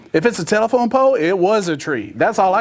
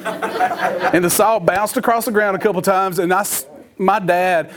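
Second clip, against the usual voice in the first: ordinary talk, nothing marked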